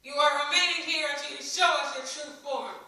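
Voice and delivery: loud stern demand. A narrator's reading. Mother of the child orders Ellie held, stern and loud. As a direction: lay down the law firmly. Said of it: "said the mother of the child".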